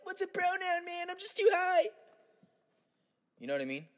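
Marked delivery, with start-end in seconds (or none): 0.0-1.9 very high, bewildered stoner whine; stoned hippie. Acted. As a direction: squeak it out like a lost stoner. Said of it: "He's too stoned to know".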